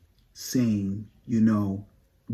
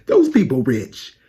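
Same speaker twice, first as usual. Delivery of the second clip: higher, louder ranting tirade; fan outrage; 9 semitones higher, 6 dB louder than usual. Acted. Fan scolding [name] for ignoring them, loud higher rant. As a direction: list every purchase like a grievance.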